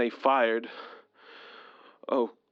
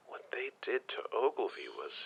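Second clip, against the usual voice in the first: haunted whisper; 9 dB quieter than usual. Acted. Recalling friend's death.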